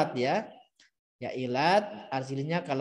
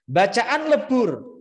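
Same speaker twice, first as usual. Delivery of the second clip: Bacaan lebur called out higher and louder; emphatic; 7 semitones higher, 6 dB louder, slower than usual. Shown as a demonstration. Teacher stressing the key term to the class, emphatic.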